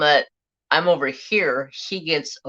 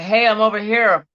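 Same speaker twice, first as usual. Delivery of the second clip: mild imitated call-out, slightly raised; retold yell; 4 semitones higher than usual. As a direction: call across the yard, half-strength. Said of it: "I yell at him".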